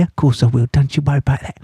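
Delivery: ordinary talk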